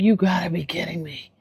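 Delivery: a stunned whisper, all breath; whispered disbelief. Retold or imitated. Disbelief at the show.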